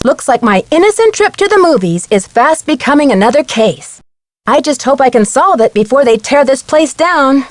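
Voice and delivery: ordinary talk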